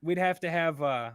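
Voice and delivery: loose, tentative Scottish-Irish accent. Shown as a demonstration. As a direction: try out a bit of Scottish with an Irish lilt. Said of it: "[name] with his Irish accent".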